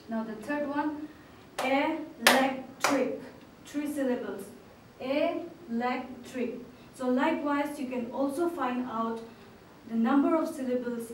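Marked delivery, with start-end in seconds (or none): 1.6-3.2 electric split slowly into el-ec-tric; syllable by syllable. Shown as a demonstration. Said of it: "You can clap your hands along with me". 5.0-6.6 Electric drawn out slowly, each syllable apart. Shown as a demonstration. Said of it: "You can clap your hands along with me".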